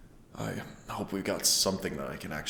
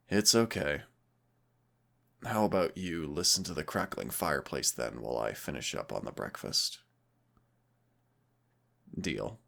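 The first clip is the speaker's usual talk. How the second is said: normal talking voice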